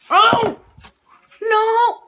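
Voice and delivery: high loud surprised cry; shocked the plate is empty. Real, not acted. Food is all gone.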